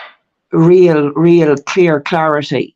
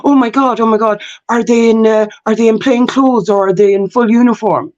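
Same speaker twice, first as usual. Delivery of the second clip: higher-pitched retold panic, questions tumbling out; mock freak-out; 6 semitones higher than usual. Solicitor asking about police outside, mock freak-out. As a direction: act out him freaking out. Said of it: "then freaks the fuck out".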